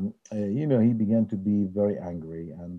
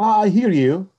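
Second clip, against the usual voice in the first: voicing the rabbi; 9 semitones higher, 8 dB louder than usual. Rabbi answers on phone.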